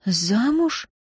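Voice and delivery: протяжно, выше, с изумлением; растянуть слово в удивлении; изумлённо. A narrator's reading. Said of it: "изумлённо протянула". (изумлённо, протяжно)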